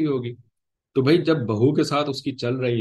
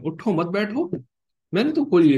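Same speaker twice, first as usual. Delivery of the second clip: acted innocent excuse; 10 semitones higher, 5 dB louder than usual. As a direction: protest innocence in a high voice. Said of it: "तो वो कहेगा".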